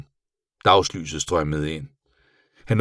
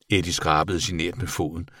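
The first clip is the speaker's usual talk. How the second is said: ordinary delivery, nothing marked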